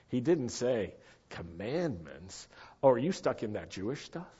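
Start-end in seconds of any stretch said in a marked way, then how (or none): 1.3-4.3 mock disdain. Preacher voicing what Yeshua didn't say, mock disdain. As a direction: act out a dismissive reply under your breath. Acting out a dismissive imagined reply.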